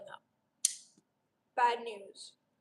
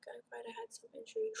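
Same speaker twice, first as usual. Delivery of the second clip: whisper; 9 semitones higher, 12 dB quieter than usual. Real, not acted. Reading comment in whisper.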